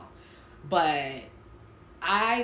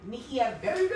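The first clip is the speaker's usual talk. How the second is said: catchphrase sign-off; 5 semitones higher than usual. Acted. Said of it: "Please say the baby".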